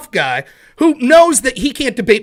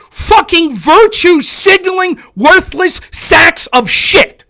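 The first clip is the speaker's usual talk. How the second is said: loud, slow, higher furious tirade; furious shouting; 6 semitones higher, 6 dB louder than usual. Real, not acted. Streamer yelling insults at a critic. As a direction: spit every insult out at him.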